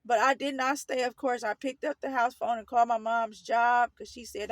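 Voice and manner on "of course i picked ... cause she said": ordinary delivery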